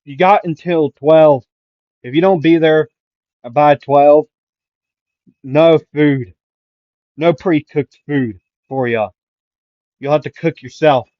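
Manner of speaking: loud, firmly spaced-out call; yelled ultimatum. Acted. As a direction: lay down the rule to a whole group. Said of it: "Aizawa would yell".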